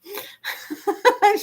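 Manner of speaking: real amused laugh; high laugh. Real, not acted. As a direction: laugh at your own joke. Laughs about Mona Lisa whispering.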